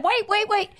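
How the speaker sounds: quick, high "wait, wait, wait"; retold alarm. Pastor telling Nan on the phone to hold on, alarmed and high. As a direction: pitch up in sudden panic.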